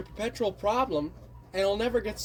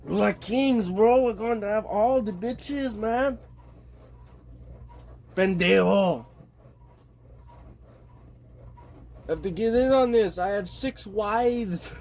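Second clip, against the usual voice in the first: bad Mexican accent; 5 semitones higher than usual. Imitating immigrants bragging about riches and wives, bad Mexican accent. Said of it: "it's like a horrible accent".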